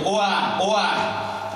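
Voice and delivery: high-pitched mock crying cry; imitated baby wail. Poet recalling their own crying, imitated high baby wail. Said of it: "Aztán sírok is".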